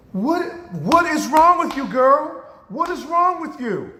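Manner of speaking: loud, high-pitched mother demanding an answer; alarmed mother. Retold or imitated. Mother to daughter who won't stop crying, acted high alarm.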